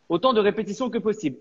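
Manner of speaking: normal talking voice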